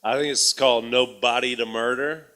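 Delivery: usual speaking voice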